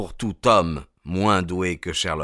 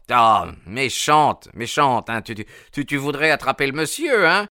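playful scolding; 5 semitones higher, 4 dB louder than usual. A narrator's reading. Old man scolding his pet ermine.

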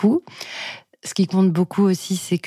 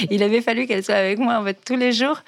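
laughing as they speak, higher and quick; 6 semitones higher, faster than usual. Real, not acted. Recalling a friend's late realisation, laughing. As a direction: start fast and high out of a laugh.

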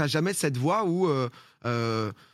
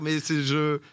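ordinary talk

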